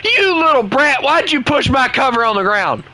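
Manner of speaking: hammed-up outrage at a naughty puppy; mock scolding scream. Yelling at his little dog for knocking the cover down, mock angry scream. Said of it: "Start screaming at the little dog".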